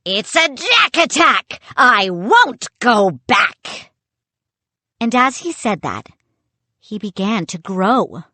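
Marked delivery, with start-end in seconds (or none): 0.1-3.9 loud pirate crowing, slowing at the end; high taunt drawn out into a slow bellow; defiant pirate puppet. Acted. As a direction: refuse it like a stubborn toy pirate.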